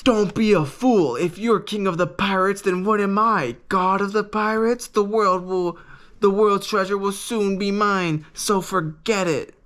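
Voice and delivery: scoffing pirate villain. Retold or imitated. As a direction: sneer at the kid's dream like a pompous captain. Buggy mocks Luffy's dream, retold.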